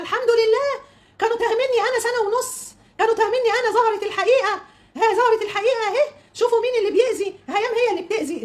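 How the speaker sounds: high, sing-out mimicry of a rival; mock triumph.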